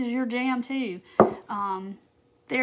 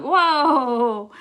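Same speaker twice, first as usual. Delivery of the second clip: amazed whoa; 6 dB louder than usual. Retold or imitated. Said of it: "and I'm like".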